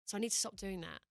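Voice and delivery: ordinary talk, nothing marked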